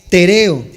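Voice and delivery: tereo stressed on the second e; tereo, loud enough and falling after the stressed RE. Shown as a demonstration. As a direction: say tereo as te-RE-o, leaning on the middle syllable. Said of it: "con acento en la segunda E".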